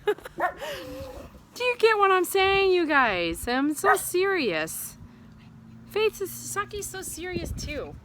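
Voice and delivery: high, drawn-out silly gushing. Real, not acted. Owner to viewers during a kiss fest, playful baby voice. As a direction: stretch it out high, as if to puppies. Playful outburst amid dog kisses.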